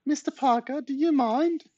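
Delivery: very high-pitched, affronted granny; mock horror. A narrator's reading. Granny seen in her underwear, high mock horror. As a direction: feign shock in a very high voice. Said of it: "said Granny in mock horror".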